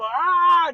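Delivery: high loud charging war cry; battle yell. Retold or imitated. Giant warrior charges at Achilles, high battle yell. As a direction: roar as you charge.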